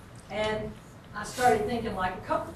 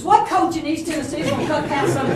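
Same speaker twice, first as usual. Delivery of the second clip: high, loud laughing disbelief; indignant laughter; 9 semitones higher, 14 dB louder than usual. Real, not acted. Mother telling the audience about her son being cut, laughing indignation. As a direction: laugh at the outrage of it.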